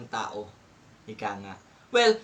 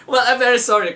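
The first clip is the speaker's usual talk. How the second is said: laughing apology; 9 semitones higher, 7 dB louder, much faster than usual. Real, not acted. Laughing apology after mockery.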